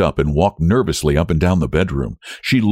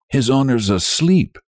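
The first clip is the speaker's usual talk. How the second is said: ordinary delivery, nothing marked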